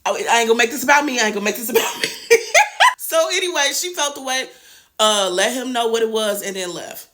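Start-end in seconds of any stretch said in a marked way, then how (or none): 2.1-2.9 loud high-pitched laugh; real squealing laugh; high burst of laughter. Real, not acted. Laughs at own aside.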